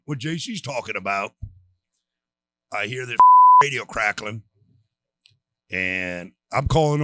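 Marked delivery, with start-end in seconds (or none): 2.7-4.4 laughing. Real, not acted. Laughing while retelling radio joke.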